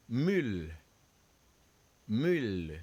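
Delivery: "mule" with a rounded French u. Shown as a demonstration. Teacher models the French u for students.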